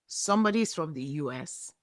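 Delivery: ordinary delivery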